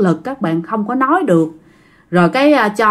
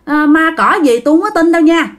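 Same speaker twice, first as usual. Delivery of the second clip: higher-pitched, emphatic refusal; stubborn refusal; 6 semitones higher than usual. Acted. Grandpa refusing to go to church, stubborn refusal. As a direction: dig in and pitch it up. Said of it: "Thì ông ngoại không chịu".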